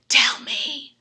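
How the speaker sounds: breathless whisper; eager whisper. Retold or imitated. To the gentleman, a breathless begging whisper. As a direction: beg for it in a whisper. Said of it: "I'm like".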